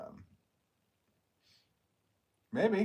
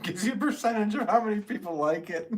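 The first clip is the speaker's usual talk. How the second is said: laughing; 13 semitones higher, 6 dB louder than usual. Real, not acted. Laughing at view counts.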